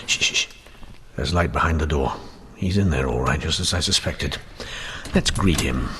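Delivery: low hushed voice, sneaking in. Acted. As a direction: keep it down so he won't hear you. Sneaking into the bank at night.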